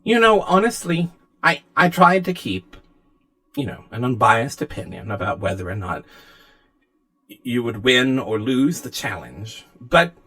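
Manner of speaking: lightly voiced, hesitant game host; lightly acted, measured concession with pauses; diplomatic AI figure. Game master plays Anna.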